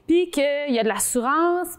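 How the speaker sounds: ordinary talk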